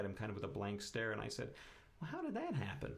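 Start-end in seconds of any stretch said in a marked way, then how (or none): 2.0-2.9 high, dazed disbelief; stunned question. Retold or imitated. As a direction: ask it like the news just hit you. Retelling his reaction to diagnosis.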